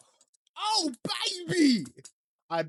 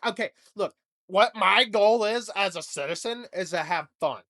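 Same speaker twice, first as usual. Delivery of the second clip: ordinary talk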